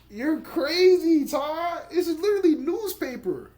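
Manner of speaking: high-pitched, stretched-out astonishment; overwhelmed amazement. Real, not acted. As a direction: squeal it in disbelief at the gift. Overwhelmed by friend's gift.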